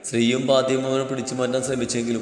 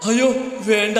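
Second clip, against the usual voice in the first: higher-pitched, exclaimed protest; alarmed refusal; 7 semitones higher than usual. A narrator's reading. Three youngsters refusing his study threat together, alarmed protest. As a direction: plead out of it in mock alarm. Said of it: "മൂന്ന് പേരും ഒരേ സ്വരത്തിൽ പറഞ്ഞതും".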